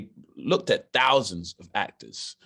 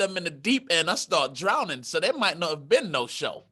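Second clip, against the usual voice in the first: ordinary delivery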